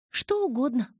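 high, emphatic and cheerful agreement; beaming confirmation. A narrator's reading. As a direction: confirm it with a big audible smile. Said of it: "подтвердила я, ослепительно улыбнувшись".

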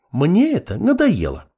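high-pitched exasperated woman; fed up. A narrator's reading. Girl to absorbed apprentice, fed up.

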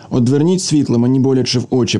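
plain reading voice

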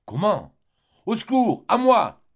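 high, loud panicked shout; husband screaming for help at his wife's deathbed. Acted. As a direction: yell for help in terror.

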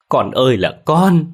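mother wailing over her daughter's desecrated grave, loud and slow; wailing cry. A narrator's reading. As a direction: let the grief break out as a long loud wail. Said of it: "Tiếng gào khóc của bà mẹ già".

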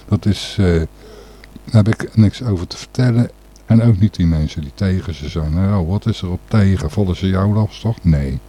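usual speaking voice

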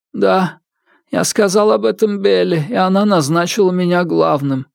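proud ghost servant. Acted. Ghost explains himself.

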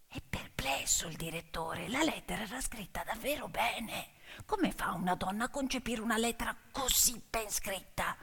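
almost whispered, soft and close; hushed narration. A narrator's reading. Narrator whispering the director's puzzled thoughts, hushed. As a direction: let the doubt hang in a whisper.

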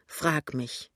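usual speaking voice

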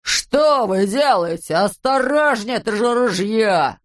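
slow, drawn-out alarmed wail; horrified cry. A narrator's reading. Policeman warning about the rifle, crying in horror. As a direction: stretch every plea in alarm. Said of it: "в ужасе закричал полицейский".

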